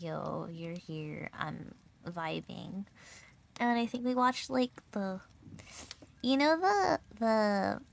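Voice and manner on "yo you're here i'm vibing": low, slow, quiet drawl; imitating someone too relaxed to move; laid-back retold greeting. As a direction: chill out on every word.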